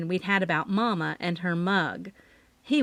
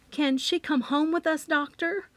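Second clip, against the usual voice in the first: trembling voice; 7 semitones higher than usual. A narrator's reading. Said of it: "Mama's voice trembled slightly".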